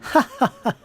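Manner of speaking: elf's high, loud laugh; laughing to herself. Acted. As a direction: laugh to yourself as the elf maiden. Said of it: "She laughs to herself".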